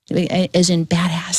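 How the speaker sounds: badass drawn out slowly and low; slowed punchline; slow emphatic badass. Real, not acted. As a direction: lean on "as in badass", slowing right down. Emphatic slowed wordplay on bad.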